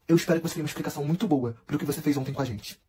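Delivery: low and soft, menacing; cold quiet threat. Retold or imitated. Thales coldly warning Davi, low and soft. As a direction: lean in and threaten him quietly. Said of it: "olhou dentro da cara dele e falou assim".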